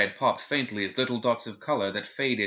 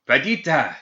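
loud, high yell of a name; 5 semitones higher, 7 dB louder than usual. A narrator's reading. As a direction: yell to rouse someone unconscious. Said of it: "he yelled".

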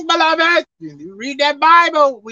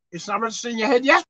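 ordinary delivery

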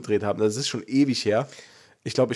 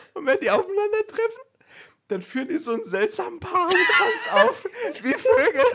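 high, laughing through words. Real, not acted. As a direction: giggle while telling it. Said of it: "laughing through the description".

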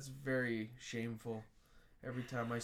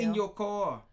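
higher, louder mock Mandarin; Mandarin accent; 5 semitones higher, 6 dB louder than usual. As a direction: insist in a put-on Mandarin accent. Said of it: "Speak with a Mandarin accent".

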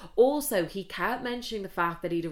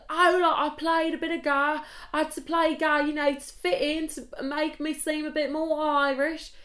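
high-pitched mock English voice; English accent; 8 semitones higher than usual. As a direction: imitate an Englishman trying to sound Irish. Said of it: "he literally spoke like this".

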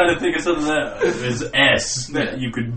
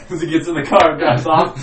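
normal talking voice

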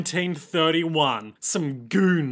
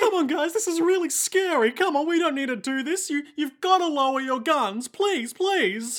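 much higher, pleading crybaby voice; Jacuzzi voice; 11 semitones higher than usual. Acted. Jacuzzi begging the goons, high and pleading. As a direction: whine it out high like a terrified coward. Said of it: "my Jacuzzi voice".

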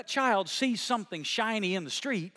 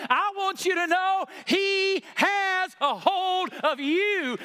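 high, loud, driving sermon climax; full-force preaching; 11 semitones higher, 4 dB louder than usual. Real, not acted. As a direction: preach it out to the whole room.

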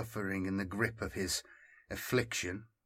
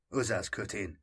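ordinary delivery, nothing marked

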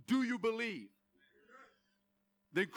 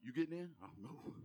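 much softer, lower, anxious murmur; worried doubt; 8 semitones lower, 13 dB quieter than usual. Retold or imitated. Believer at heaven's gate unsure he'll get in, timid murmur. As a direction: fade into timid uncertainty. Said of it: "He didn't want you to get up there going".

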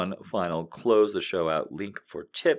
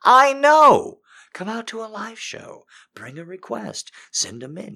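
excited; 11 dB louder than usual. Real, not acted. Playful plea to listeners.

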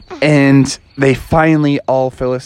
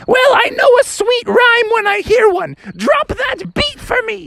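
much higher, eager frog mom; high voice, begging for a beat; Mother Frog voice. Retold or imitated. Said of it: "She's like".

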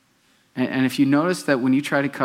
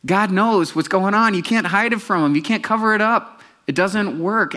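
higher, louder emphatic preaching; fervent pulpit declaration; preaching at full force; 9 semitones higher, 5 dB louder than usual. Acted. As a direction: drive it home to the congregation. Preaching climax.

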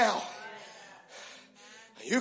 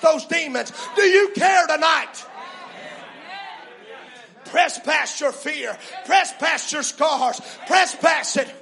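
fierce commanding prayer; 5 semitones higher than usual. Real, not acted. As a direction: preach it at full force. Climax of preaching.

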